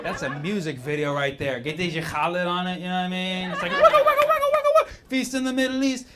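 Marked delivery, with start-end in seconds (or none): 3.7-4.9 very high squeaky record-scratch wugga; imitating a DJ scratch sound, high and loud; mock DJ scratch. Imitating [name] beat.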